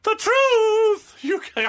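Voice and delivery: very high, loud diva send-up; botched, over-the-top showbiz impression. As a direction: belt it out high like a stage star.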